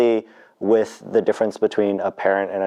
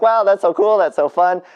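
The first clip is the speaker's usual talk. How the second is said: higher-pitched, gushing retold reaction; retold enthusiasm; 10 semitones higher, 3 dB louder than usual. Retelling her reply to a friend's Cantonese plan, enthusiastic. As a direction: act out being impressed by a friend's news.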